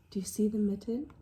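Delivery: ordinary delivery, nothing marked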